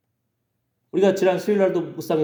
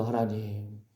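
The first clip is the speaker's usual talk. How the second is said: mother's lament, low and very soft; imitated tearful prayer; 6 semitones lower, 10 dB quieter than usual. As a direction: plead quietly to God as a weary mother, without real sobbing. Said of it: "엄마는 울면서". (엄마의 울음 섞인 기도를 나직이 흉내)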